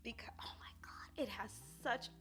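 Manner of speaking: breathless whisper near tears; too moved to speak aloud; choked whisper. Real, not acted. To viewers, a choked whisper while tearing up. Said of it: "I will get so emotional right now".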